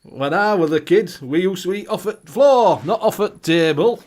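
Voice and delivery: loud, exaggerated Yorkshire accent; broad Yorkshire. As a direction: play the old Yorkshire miner. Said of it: "proper Yorkshire".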